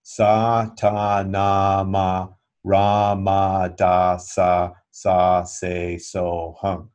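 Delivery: all twelve syllables said in an even demonstrating rhythm; full mantra recited. Shown as a demonstration. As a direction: recite the whole mantra, one syllable per finger. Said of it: "the twelve syllables of the mantra".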